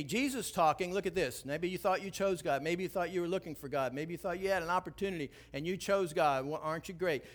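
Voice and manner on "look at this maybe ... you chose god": ordinary delivery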